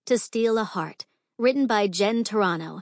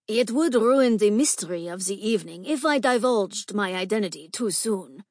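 raspy fake gentleman's voice, pushed louder; raspy masculine disguise; 4 dB louder than usual. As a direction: pass yourself off as a man with a rasp. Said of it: "Gabriella returned in a raspy voice".

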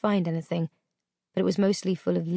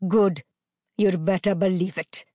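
louder, hard menace; menacing; 3 dB louder than usual. A narrator's reading. Captor warning the terrified girl. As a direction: drive the threat home. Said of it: "captor threatening".

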